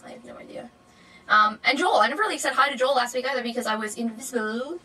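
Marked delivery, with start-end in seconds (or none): 0.1-0.7 unvoiced, much softer whisper; whispered give-up. Real, not acted. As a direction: breathe it out as a helpless whisper. Gives up on the word.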